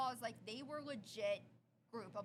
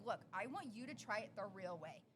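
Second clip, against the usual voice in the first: usual speaking voice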